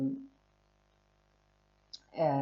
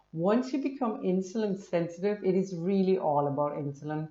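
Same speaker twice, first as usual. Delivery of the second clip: ordinary delivery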